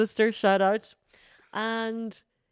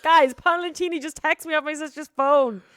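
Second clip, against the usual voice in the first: much higher and louder gushing; excited announcement; 11 semitones higher, 7 dB louder than usual. Retold or imitated. Announcing to her followers that the singer texted, excited. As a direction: burst out with the news.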